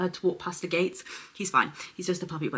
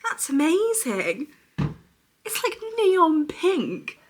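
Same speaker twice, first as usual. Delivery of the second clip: awed excitement; 10 semitones higher, 5 dB louder, much slower than usual. Real, not acted. Thrilled at gel colour.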